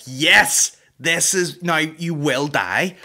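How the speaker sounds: acted-out gleeful "finally!" outburst; triumphant cheer. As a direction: cheer on a villain with fierce relish. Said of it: "and you're like".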